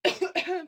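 short real cough; coughing fit. Real, not acted. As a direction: cough once mid-sentence. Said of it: "sorry, ik moet even hoesten".

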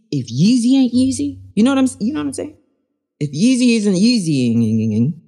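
loud, insistent Yeezy repeated; emphatic rhetorical stress. Real, not acted. Host insisting to listeners, loud and emphatic. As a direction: lean hard on each Yeezy.

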